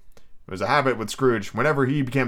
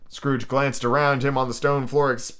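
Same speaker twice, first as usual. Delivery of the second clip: ordinary delivery, nothing marked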